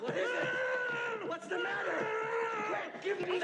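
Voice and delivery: high, urgent shouting; alarmed shout. Acted. The doctor calling out in alarm, shouting. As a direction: shout for help in a panic.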